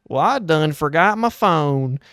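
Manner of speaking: grandpa. Retold or imitated. Old man at the tire shop door realizes his mistake, mock grandpa voice. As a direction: drawl it out like a forgetful grandpa. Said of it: "like, the grandpa shuffle".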